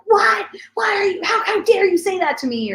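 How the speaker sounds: high, loud indignant squeal; mock outraged parent. Shown as a demonstration. Mother demos how not to answer her son, high and outraged. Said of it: "the worst thing I could do is be like".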